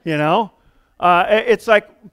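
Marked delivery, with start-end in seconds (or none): none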